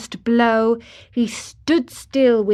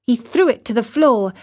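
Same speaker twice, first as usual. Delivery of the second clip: ordinary delivery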